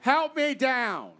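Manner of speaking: high, drawn-out call for help. Real, not acted. Judge leaving the stage for the audience, calling out.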